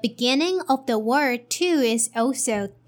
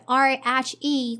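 usual speaking voice

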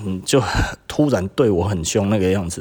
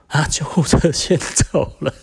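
mocking echo; 6 semitones higher, slower than usual. Mocking the employee's excuse.

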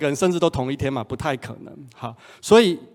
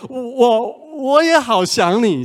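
higher, louder, slow stammer; stammering longing reply; 9 semitones higher, 6 dB louder, slower than usual. Retold or imitated. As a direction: echo her longing, tripping over the words. Boyfriend replying in kind. (高声、结巴地回应想念)

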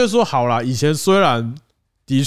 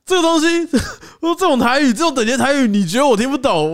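higher indignant rant, half joking; a touch of hammed-up fury, higher pitched; mild mock anger; 9 semitones higher than usual. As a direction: vent like you've been insulted. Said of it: "有点小怒".

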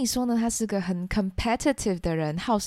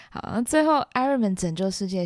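normal talking voice

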